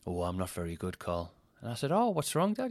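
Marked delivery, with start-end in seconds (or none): 0.1-1.3 low, quiet, lightly put-on disappointment; mock upset dad. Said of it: "acting as if he was upset". 1.9-2.7 put-on high, innocent kid's question; small child. Small child asks upset dad.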